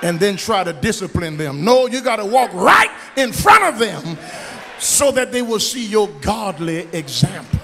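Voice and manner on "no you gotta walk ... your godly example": louder preacher exhortation; preaching shout. Acted. Pastor warning parents to live right, shouting. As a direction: tell the parents straight.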